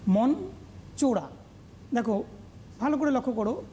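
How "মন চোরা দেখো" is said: normal talking voice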